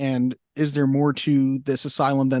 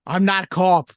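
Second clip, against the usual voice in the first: mock Boston movie line; hammed-up Boston accent; 3 semitones higher, 4 dB louder than usual. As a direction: play a Bostonian, put-on accent. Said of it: "say it with a Boston accent too".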